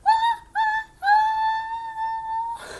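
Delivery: high, loud drawn-out ah notes; sung sneeze build. Acted. Gerald winding up a sneeze, high drawn ah. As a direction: stretch the sneeze wind-up like a song.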